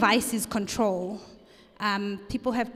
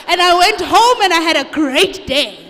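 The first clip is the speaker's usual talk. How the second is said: much louder, higher and gleeful; real burst of triumph; loud exultant punchline; 6 semitones higher, 13 dB louder than usual. Real, not acted. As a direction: belt out the happy ending. Triumphant end to crying story.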